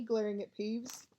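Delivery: plain reading voice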